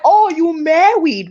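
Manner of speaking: imitating a Haitian man, "Oh, you married"; surprised Haitian man teasing; Haitian accent. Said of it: "with the Haitian accent".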